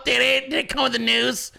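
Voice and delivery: ordinary talk, nothing marked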